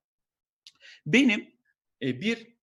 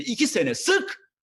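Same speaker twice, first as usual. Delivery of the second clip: mock manager; 9 semitones higher, 4 dB louder, slower than usual. Voicing the manager.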